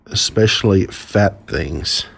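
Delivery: ordinary delivery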